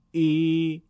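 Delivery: ای held long and level. Shown as a demonstration. Teacher showing ya maddi beside kasra, prolonged. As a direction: stretch ای over two beats. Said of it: "یاء مدی در دو ضرب".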